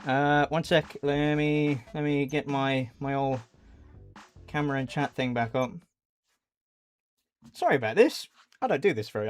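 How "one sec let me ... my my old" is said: usual speaking voice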